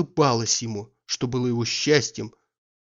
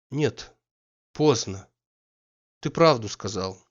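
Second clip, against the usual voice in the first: pensive dejection; slower than usual. A narrator's reading. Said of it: "задумчивым унынием говорила она".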